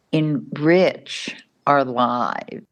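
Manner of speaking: normal talking voice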